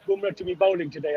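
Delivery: ordinary delivery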